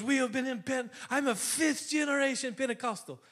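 put-on high-pitched Irish accent. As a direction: imitate an Irishman eagerly claiming his heritage. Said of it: "He's got this great accent".

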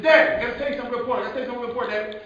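put-on excited child's rush, high and loud; talking real fast. Daughter with important news for Dad, talking real fast. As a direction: tumble the words out urgently. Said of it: "she's talking real fast".

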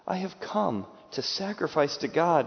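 ordinary delivery

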